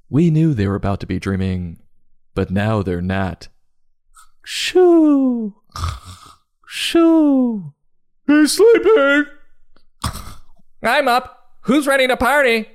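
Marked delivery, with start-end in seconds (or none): 4.4-9.3 high hushing character voice. Acted. 10.8-12.7 rowdy party animal. Acted. Porter wakes up loudly.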